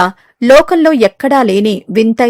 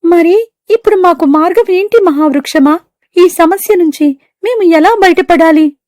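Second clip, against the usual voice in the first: high, small bird voice asking; hopeful bird; 7 semitones higher than usual. Acted. Birds asking the great tree what to do. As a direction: ask humbly for a way out.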